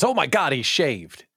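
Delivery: usual speaking voice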